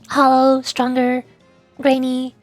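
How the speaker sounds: usual speaking voice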